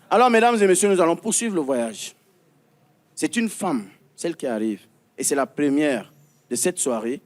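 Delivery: emcee introducing an act, last name stretched; grand entrance announcement. Acted. Host announces the next act.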